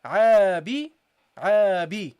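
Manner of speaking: عابي said loud and clear as a model; demonstrated pronunciation. Shown as a demonstration. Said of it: "so you say here".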